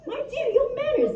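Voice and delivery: high, prim, proper gentleman voice; posh gentleman. Retold or imitated. She imitates her polite lover in a prim, high gentleman voice. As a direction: play him as a genteel old-fashioned gent. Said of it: "he says".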